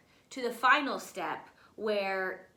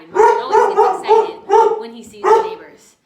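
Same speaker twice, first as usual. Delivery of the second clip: loud and high over the dog; over the barking; 8 semitones higher, 18 dB louder, faster than usual. Real, not acted. Teacher talking over excited dog Marvin, loud.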